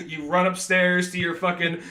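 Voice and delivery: usual speaking voice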